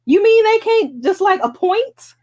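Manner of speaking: you can't be serious, high and baffled; high, incredulous outburst. Real, not acted. Incredulous question.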